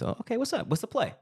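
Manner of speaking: high, casual voice for a quick check-in with God; asking God what's up. Retold or imitated. Asking Allah what the play is, high and casual.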